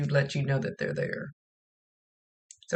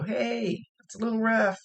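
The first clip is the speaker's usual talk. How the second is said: spirit calling out; 7 semitones higher than usual. Retold or imitated. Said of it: "and go".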